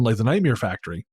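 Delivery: normal talking voice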